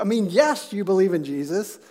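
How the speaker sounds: ordinary talk, nothing marked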